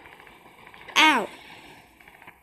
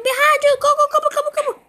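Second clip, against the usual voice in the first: much higher, loud frantic yell; frantic shouting; 12 semitones higher, 10 dB louder, much faster than usual. Real, not acted. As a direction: scream the warning in panic. Frantic warning to teammate.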